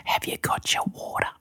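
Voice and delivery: hushed whisper, imitating dad; real quiet. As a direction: repeat the teasing question under the breath, whispered. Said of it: "just real quiet too".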